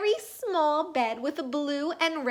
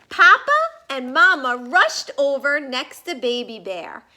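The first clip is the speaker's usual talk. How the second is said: plain reading voice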